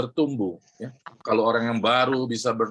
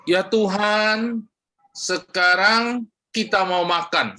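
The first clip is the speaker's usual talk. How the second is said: higher, very slow, mock-solemn prayer; wrong prayer demo; 6 semitones higher, slower than usual. Shown as a demonstration. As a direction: pray it grandly, as the wrong example.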